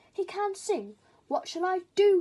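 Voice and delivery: high, loud wail of despair; despairing cry. Acted. Father crow despairing over his son, loud cry. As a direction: cry it out in alarm. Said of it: "he cried".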